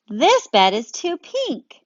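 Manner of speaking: a bear scrunching at pink; slow, high fussy bear. Acted. Bear voiced in read-aloud story.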